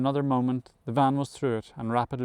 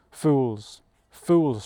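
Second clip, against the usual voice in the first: emphatic, falling storyteller's shout; a contained narrated shout; scornful shout; 4 dB louder than usual. A narrator's reading. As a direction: cry out with force, each word dropping away. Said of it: "shouted Benjamin".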